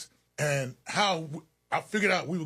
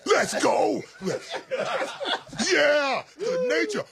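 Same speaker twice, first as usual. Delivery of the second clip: Ric Flair; 12 semitones higher, much slower than usual. Retold or imitated. Wrestler firing up the guys before the ring, hammed-up yell. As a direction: whoop it up like a showboating wrestler. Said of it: "I'm here with Ric Flair".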